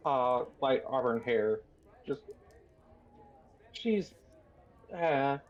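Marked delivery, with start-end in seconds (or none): none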